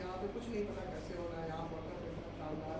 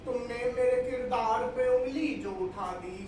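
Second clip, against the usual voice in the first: raised, measured verse delivery; mushaira poetry recitation; 4 semitones higher, 9 dB louder, slower than usual. Acted. Poet reciting couplets to a mushaira audience, declaimed verse. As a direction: declaim each line to the crowd.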